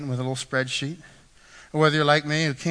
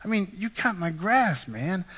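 slow start, higher pleading pitch; retold tearfulness; 5 semitones higher, slower than usual. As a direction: imitate a man moved to tears. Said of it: "got tears in his eyes".

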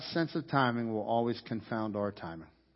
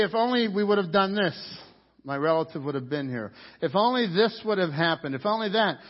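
louder, higher lament; mock regret; 6 semitones higher, 5 dB louder than usual. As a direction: wail the if-onlys lightly. Mimicking regretful people.

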